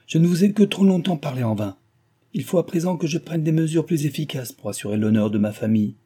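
mother threatening firmer measures, violently agitated; violent agitation. Acted. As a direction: lay down the law for the family's honour. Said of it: "l'agitation la plus violente".

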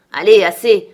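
stern father's loud rebuke; sharp command. Acted. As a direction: shout it to silence a crying child. Heathcliff orders crying son to stop.

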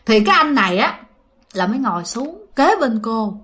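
ordinary delivery, nothing marked

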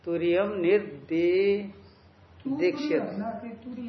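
slow, syllable by syllable for students; slow dictation. Shown as a demonstration. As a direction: dictate it slowly so the class can write. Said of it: "तो च को काट करके तु करिए".

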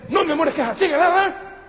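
high, loud pleading husband; husband arguing loudly with his wife. Retold or imitated. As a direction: insist to the wife, voice up. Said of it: "el hombre le dice".

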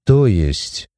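low, contained menace; understated menacing question; quiet threat. A narrator's reading. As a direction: ask it with a veiled threat. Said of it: "Тихо, но с угрозой в голосе проговорил Лука".

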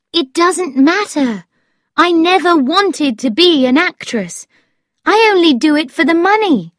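put-on high-pitched, lively girl's voice; keen storybook young woman; Thetis, eager. A narrator's reading.

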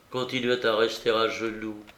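usual speaking voice

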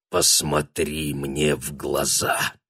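тихо, медленно, зловеще; зловещий тихий приказ; sinister whisper. A narrator's reading. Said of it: "прошептал он зловещим голосом". (зловещий шёпот)

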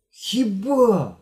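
astonished one-word question; surprised. A narrator's reading. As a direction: gasp it in disbelief. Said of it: "здивувався Генка".

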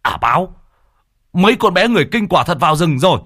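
high, loud alarmed shout; old shaman bursting out in alarm. Acted. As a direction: yell the news in panic. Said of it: "Mò Bá Cha hét lên".

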